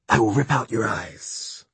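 menacing demon threat; hissing demon. Acted. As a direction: hiss it like a small demon. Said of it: "hissed the creature".

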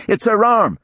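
high, loud panicked yell. Acted. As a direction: shout it in alarm. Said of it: "One of the technicians yelled".

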